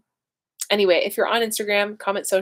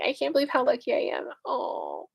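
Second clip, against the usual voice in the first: tearing up; 6 semitones higher, 3 dB quieter than usual. Real, not acted. Moved by her mom watching the stream, tearing up. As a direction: voice catching into tears. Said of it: "now I'm gonna cry".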